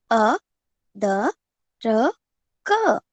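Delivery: अदरक stretched out very slowly, syllable by syllable; teacher stretches out the word. Shown as a demonstration. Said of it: "समझ में आ रहा है उच्चारण कैसे करते हैं".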